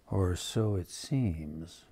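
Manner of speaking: slow, soft and ominous. A narrator's reading. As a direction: drop it to a hush, slow. Ominous story turn.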